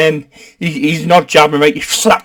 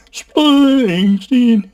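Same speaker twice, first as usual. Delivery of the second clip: drawn-out name; 7 semitones higher than usual. Real, not acted. Searching the web for [name], high and drawn-out name.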